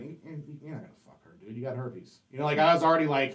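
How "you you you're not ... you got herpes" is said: quick, soft inner-voice putdown; retold negative self-talk, hushed and hurried; self-berating. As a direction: talk yourself down under your breath. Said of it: "I would talk shit to myself in my head".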